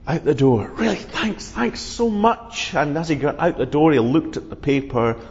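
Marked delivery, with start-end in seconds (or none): 0.8-2.7 slow, higher, delighted thanks; grateful Thomas. Acted. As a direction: draw out the thanks, pitched up. Thomas leaving beaming.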